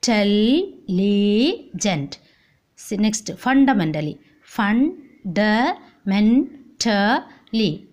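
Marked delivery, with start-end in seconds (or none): 0.0-2.2 very slow "intelligent" in four separate beats; long gap after each syllable of "intelligent"; split into syllables. Shown as a demonstration. Teacher showing a polysyllabic word, very slow syllable by syllable. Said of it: "Three or more words used it". 4.4-7.9 split into syllables. Shown as a demonstration. Teacher counting five syllables for class, very slow syllable by syllable. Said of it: "One, two, three, four, five".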